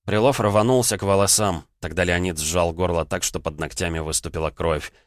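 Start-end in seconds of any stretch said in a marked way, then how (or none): none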